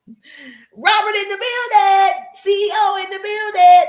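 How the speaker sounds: loud, slow, high sing-song shout-out; hype shout-out. Real, not acted. Greeting viewers arriving in her live stream, loud and sing-song. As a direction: call out each name like a hype host. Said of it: "shouting out live viewers".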